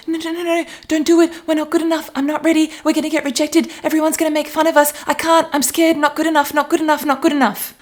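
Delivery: put-on high, fast, anxious little-kid pleading; scared inner child. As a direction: panic like a frightened child about to be rejected. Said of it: "the voice is like".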